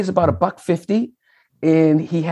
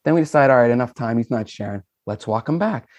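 ordinary talk

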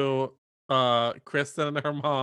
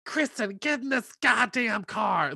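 mock yelling mom; 7 semitones higher, slower than usual. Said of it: "she's like".

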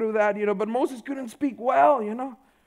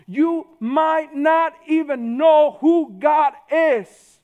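louder, slow, every word weighed; preaching emphasis; 6 semitones higher, 7 dB louder, much slower than usual. Real, not acted. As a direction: hammer the line home to the congregation. Preacher driving the point home.

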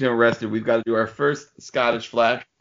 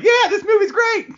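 squeaky high puppet voice, much louder; ventriloquist puppet voice; 5 dB louder than usual. Pretend. Mocking the host as his puppet, high and loud. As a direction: chirp it like a dummy on a knee. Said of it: "like, a ventriloquist puppet".

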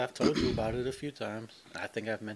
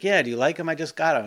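eager, higher, louder quote; mock eager Brett; 5 semitones higher, 10 dB louder than usual. Brett showing his new shoes to grandma, eager and loud.

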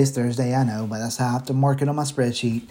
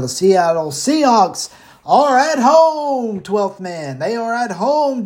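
hyped announcer; 7 semitones higher, slower than usual. Real, not acted. Hyping the home crowd.

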